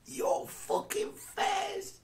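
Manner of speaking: squealed very high and slow, mocking; mock gotcha shout. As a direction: shriek it out as the prank's punchline. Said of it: "Zhukov's like".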